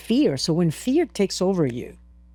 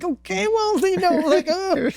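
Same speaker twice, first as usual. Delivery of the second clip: high-pitched whimper, played for laughs; mock crying; 11 semitones higher than usual. Climber hugging oxygen tank as teddy bear, mock crying. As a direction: imitate a tearful wail to a teddy bear. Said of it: "and, like, crying".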